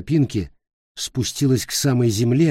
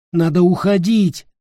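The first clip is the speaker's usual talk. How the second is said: urgent; 8 semitones higher, 5 dB louder than usual. Acted. Nick urges leaving.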